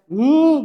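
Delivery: loud, high "hmm" sound effect; imitated vehicle-turn noise. Teacher showing how the mare was swung round, loud imitated hum. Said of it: "गाड़ी घुमाते हैं ऐसे".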